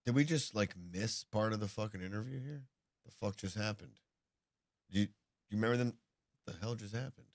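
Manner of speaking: speaking through a hand over the mouth; muffled, as if behind a hand. Real, not acted. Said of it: "you're a little muffled there".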